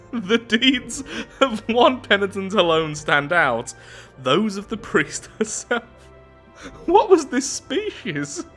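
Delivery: high, slow, struggling through laughter; real laughing. Real, not acted. Player baffled by a strange species, helpless laughter. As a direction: fight the giggles as you read on. Still laughing at the absurd text.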